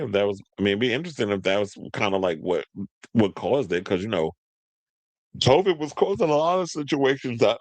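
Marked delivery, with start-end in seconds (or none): 5.4-7.6 talking through a real yawn, higher pitch; words swallowed up by a yawn. Real, not acted. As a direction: let a yawn stretch the words. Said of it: "I'm yawning on camera".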